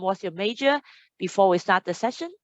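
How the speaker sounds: normal talking voice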